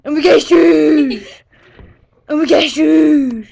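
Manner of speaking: loud slow fake sobbing; hammed-up crying breaking into laughter. Mock crying repeated.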